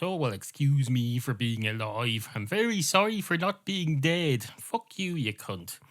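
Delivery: huffy, over-emphatic comeback in a cat squabble; sarcastically sorry, pushed loud and high; indignant sarcasm. Acted. Cats quarrel, indignant sarcastic retort.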